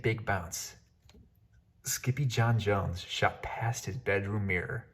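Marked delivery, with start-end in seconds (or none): none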